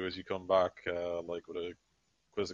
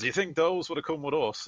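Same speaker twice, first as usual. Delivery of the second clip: higher, louder gruff soldier quip; Crater's voice; 9 semitones higher, 6 dB louder than usual. Acted. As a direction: toss it off with a shrug in Crater's voice. Crater's shrugging reply in play.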